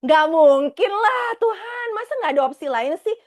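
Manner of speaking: incredulous, pleading what, him?; shocked disbelief. Retold or imitated. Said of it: "Aku bilang gini".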